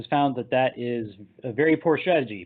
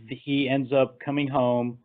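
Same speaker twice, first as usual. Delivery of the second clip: ordinary talk, nothing marked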